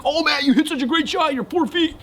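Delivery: high fake-cheerful friend voice; mock friends teasing. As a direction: play the prankster friend faking good news. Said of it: "they're gonna ... be like".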